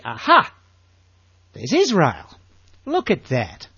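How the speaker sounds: loud pleased "aha", a bit hammed-up; eager discovery. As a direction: play the delighted Bible reader finding it. Acted discovery while reading Bible.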